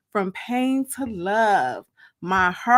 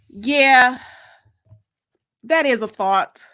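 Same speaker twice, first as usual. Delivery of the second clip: story narration. A narrator's reading. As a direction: read it like a dramatic reading for your listeners. Reads her book aloud.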